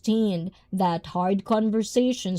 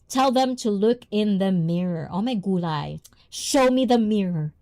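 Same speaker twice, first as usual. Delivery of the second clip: usual speaking voice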